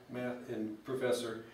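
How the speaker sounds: ordinary talk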